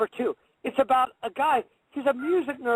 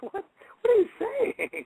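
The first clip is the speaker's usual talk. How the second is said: jumps much higher in shock; stunned question; 9 semitones higher than usual. Real, not acted. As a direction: blurt it out, taken aback. Shocked by co-host's confession.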